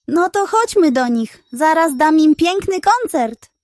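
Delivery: high, loud eager hen. Acted. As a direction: burst with eagerness to perform.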